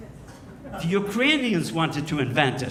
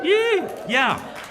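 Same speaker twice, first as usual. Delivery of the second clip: high squeaky yeah; mock cheer; 12 semitones higher than usual. Comedian mocking Irish drinking, high squeaky yeah.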